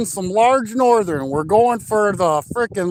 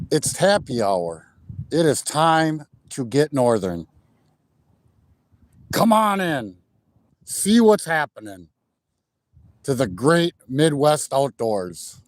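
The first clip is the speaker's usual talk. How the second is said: deep announcer intro; 7 semitones lower than usual. Acted. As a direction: open the show like a deep-voiced outdoors host. Deep show-intro announcer opening.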